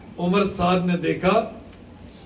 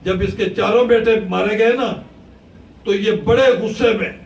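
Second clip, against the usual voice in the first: preaching at full force; 4 semitones higher, 4 dB louder than usual. Acted. Preacher narrates Abbas's anguish, loud and driving. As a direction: drive the battle story home to the crowd.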